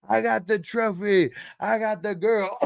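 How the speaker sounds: high, loud cocky shout of victory; hyped boast. Retold or imitated. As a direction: crow it like a cocky winner. Retelling Andre's hyped victory boast.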